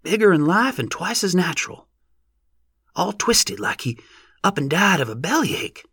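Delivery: put-on rustic old-timer voice. A narrator's reading. Same rustic onlooker continues.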